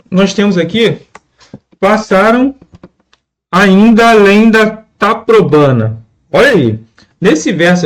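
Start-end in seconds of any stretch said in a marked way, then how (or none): 1.8-6.0 slow, measured verse reading; scanning the verse. Shown as a demonstration. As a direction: read ainda além slowly to show the fused vowels. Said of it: "Olha que a pronúncia vai trazer pra nós".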